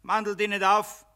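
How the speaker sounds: loud, high contemptuous shout; angry shout. Acted. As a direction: bark him down as a fool. Mammon scolds Jedermann.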